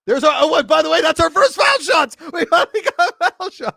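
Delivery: loud, high-pitched celebration; real elated outburst; excited shouting. Real, not acted. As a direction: yell it out as if finally vindicated. Celebrating first foul shots.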